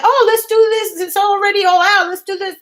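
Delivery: hammed-up let's-go enthusiasm; eager. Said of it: "I said".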